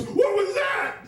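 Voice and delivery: very high mock shriek; alarmed shriek. Wife startled on operating table, alarmed shriek. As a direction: shriek the question like a frantic wife.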